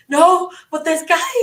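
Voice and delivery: high, loud re-enacted objection; exasperated protest. Applicant objecting when the second agent refuses help, exasperated protest.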